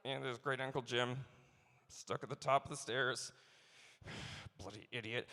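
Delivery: low, dry, muttered family remark; weary descendant. Retold or imitated. Descendant showing off the family ghost, low dry grumble. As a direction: grumble about the relative on the stairs. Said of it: "some descendant can be like".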